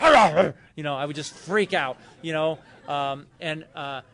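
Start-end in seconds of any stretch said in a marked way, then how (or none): 0.0-0.5 loud grumpy snarling growl; mock furious growls, loud. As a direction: growl it out loud like a cranky teen. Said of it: "I'd be like".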